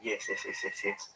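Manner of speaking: ordinary delivery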